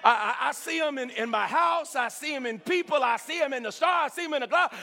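usual speaking voice

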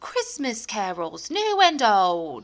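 slow, hammed-up Cockney accent. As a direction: drag it out in bad Cockney. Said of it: "That's a really bad Cockney accent".

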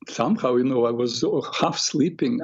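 usual speaking voice

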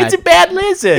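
very high, cartoonish squeaky voice; silly voice. Hosts riffing on the monster, in a very high cartoon voice. Voicing a goofy character.

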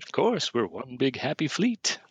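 high mocking sneer, slow; sarcastic Khan. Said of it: "sarcastic Khan".

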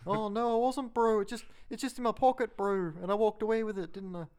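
high, mock Kiwi accent pleading innocence; mock New Zealand suspect. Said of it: "Police ten seven, bro".

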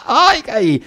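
high, shrieking mock revival whoops; hammed-up ecstatic church shouting, voice leaping up; theatrical worship frenzy. Preacher mimicking showy Christians at praise time, theatrical shrieking. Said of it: "muy teatreros, ¿verdad? Como estos cuates".

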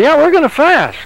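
mock-firm, overruling the body; insistent. Preacher insisting to his own body they will fast.

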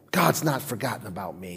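low, hushed "God's not forgotten about me"; soft affirmation. Shown as a demonstration. Preacher modelling the line quietly for the congregation. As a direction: speak it softly as a truth.

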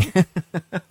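breaking into high-pitched laughter; helpless high giggle; real laugh, pitched right up. Real, not acted.

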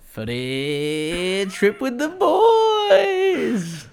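high, slow, laughing drawl; impression. Host doing a hammed-up impression for his co-host. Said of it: "Hoops Valley?".

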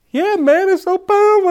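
high, strained mock-weepy voice; hammed-up tearful gush; mock crying. Youth pastor answers while crying.

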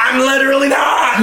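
sudden high yell; mock shout. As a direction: shout it out in protest. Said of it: "you wanna scream?".

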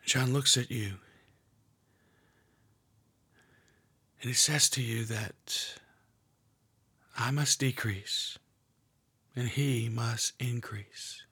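hushed guided meditation, half whispered; guided prayer meditation. Acted. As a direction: lead them gently into prayer.

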